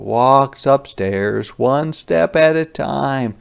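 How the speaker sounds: ordinary talk, nothing marked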